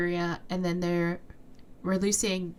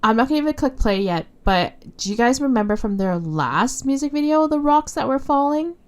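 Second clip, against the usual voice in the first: excited; 6 semitones higher, 7 dB louder than usual. Real, not acted. Excited theory before pressing play.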